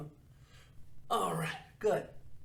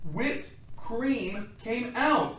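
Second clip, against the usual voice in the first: surprised reveal; 6 semitones higher, 5 dB louder, much slower than usual. A narrator's reading.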